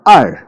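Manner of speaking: "अर" falling sharply and loud; fourth tone. Shown as a demonstration. Teacher isolating the fourth tone on two, sharp fall. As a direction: snap "अर" straight down. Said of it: "अर के ऊपर fourth tone".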